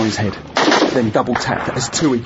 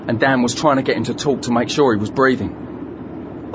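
ordinary delivery, nothing marked

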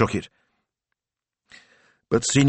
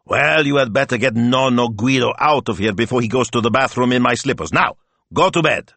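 put-on loud, raised-pitch accented grumbling order; exasperated bedtime command, building higher; exasperated grandpa; 5 semitones higher, 4 dB louder than usual. A narrator's reading. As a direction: order them out, fed up. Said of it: "Signor Speranza rolled his eyes".

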